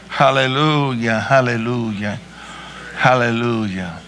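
low, deep worship murmur that leans into each hallelujah; praying aloud to God, low and devout; fervent prayer. Real, not acted.

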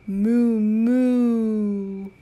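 loud drawn-out moo; cow moo. Pretend. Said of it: "He can sound like a cow".

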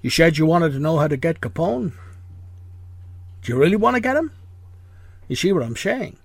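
a hint of Connery's Scottish menace; Sean Connery impression. Host plays Malone to guest's Ness: lightly voiced Connery. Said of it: "have a Sean Connery accent".

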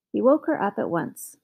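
ordinary delivery, nothing marked

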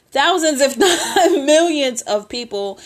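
breaking into real laughter; laughing. Real, not acted. Cracks herself up mid-point.

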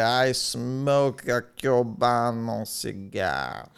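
slow, savoured mock brag; drawn-out, self-important announcement; status boast. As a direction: stretch every word with showy relish. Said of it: "You want that ability to say".

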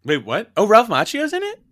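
can't believe Ralph Macchio was in it; surprised. Real, not acted. Friend hears Ralph Macchio is cast, shocked high question.

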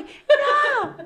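high, loud telenovela wail; mock desperate cry. Girlfriend chasing her boyfriend at a party, loud high wail. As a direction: cry out after him like a soap heroine. Running after him crying.

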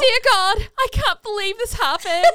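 put-on high, loud Derry accent, laughing; Northern Irish accent. Friend quoting the girl, high Northern Irish accent. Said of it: "could do some of the accents".

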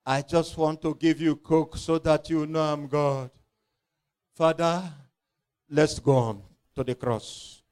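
a hint of a tender, smiling saviour; smiling Jesus. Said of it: "And Jesus will smile and say".

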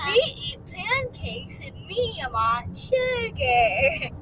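silly voice, dragged out slow at the end; sugar-high rat. Acted. Rat answering Dragon, sugar-high silly voice. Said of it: "Rat looks sugar high".

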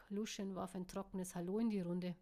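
plain reading voice

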